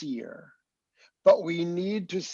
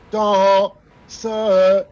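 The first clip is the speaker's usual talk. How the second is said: "Dans ce" sung loud, leaning on the long note; mock the wrong accent on the long note; sung with a heavy long note; 4 semitones higher, 9 dB louder than usual. Shown as a demonstration. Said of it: "you're making the long note an accent".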